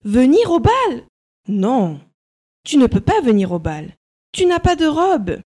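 high, loud scornful; mocking stepsisters. A narrator's reading. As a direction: sneer at her. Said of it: "disent ses sœurs".

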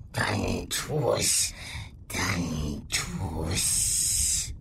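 slow, coaxing creature voice; purring beast. Acted. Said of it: "säuselte die Bestie".

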